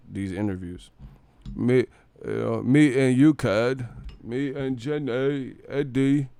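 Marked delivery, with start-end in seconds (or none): 1.6-6.3 slow Forrest Gump drawl; mocking slow, simple impression; Joshua as Forrest Gump. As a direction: drag the words out like Forrest Gump. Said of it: "how Joshua kinda sound to me".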